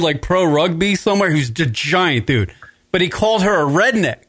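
ordinary talk

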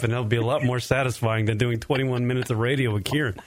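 ordinary talk, nothing marked

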